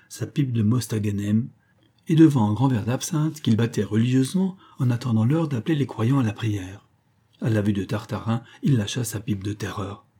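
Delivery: ordinary delivery, nothing marked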